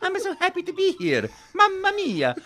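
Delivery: high, bouncy Italian plumber; big cheerful Mario, way up high. Acted. Voice actor greeting the host as Mario. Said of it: "Charles Martinet is here".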